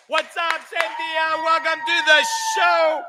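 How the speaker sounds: high, shouted welcome; excited greeting. Real, not acted. Host welcomes Cynthia onto the stage, shouted excited greeting. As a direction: greet her like a big star. Greeting the new comic loudly.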